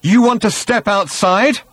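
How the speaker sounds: high, loud, slow dare; snarled. A narrator's reading. Arthur challenging Thor to fight, snarled. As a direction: dare him, each word drawn out. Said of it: "he snarled at Thor".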